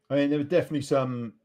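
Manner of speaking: normal talking voice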